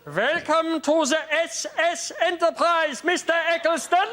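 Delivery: high, slow, otherworldly greeting; high alien voice. Acted. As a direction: sing it out like a strange being. Alien greeting a Time Lord.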